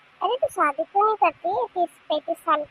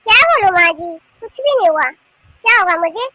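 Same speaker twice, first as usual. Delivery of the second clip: loud, irritated denial; cross; 2 semitones higher, 7 dB louder than usual. Acted. Bahu denying anything is wrong to her mother-in-law, crossly. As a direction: answer back in a raised, huffy voice. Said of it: "फिर इतने गुस्से में क्यों है तू".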